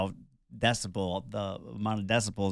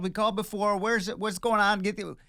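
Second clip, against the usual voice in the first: high, urgent panicked calls for help; frantic yelling; 11 semitones higher than usual. Retold or imitated. Husband bursting into the hospital, yelling for a wheelchair. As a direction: shout for security like a desperate husband. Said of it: "just start yelling".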